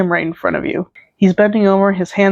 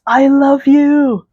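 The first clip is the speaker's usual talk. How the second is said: high, loud, drawn-out cry; fangirl shout; 7 semitones higher, 5 dB louder, much slower than usual. A narrator's reading. Girl in the concert crowd to the band, shouting. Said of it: "girl right next to you shouts".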